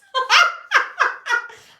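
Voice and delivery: loud, high laugh; real high cackle; burst of laughter. Real, not acted. Laughs at own question.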